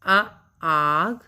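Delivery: अ kept short, then आग with the आ held very long; short and long vowel demo. Shown as a demonstration. Teacher contrasting अ and आ for children, very slow. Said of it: "आ स्वर को बोलते हैं, तो जो हमारा समय है, वह दुगना".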